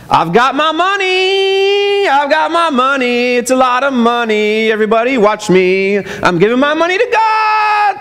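parade your giving like a Pharisee; showy hypocrite. Retold or imitated. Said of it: "That's what they would do".

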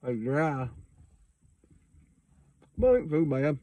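words between chews; talking while chewing. Real, not acted. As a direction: mouth partly full. Seen 1: lips closed, jaw working between words after eating a snack.

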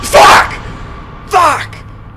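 loud, high screamed curse; frightened shouting. Real, not acted. Player caught while escaping, frightened shout. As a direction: scream the swear word in shock.

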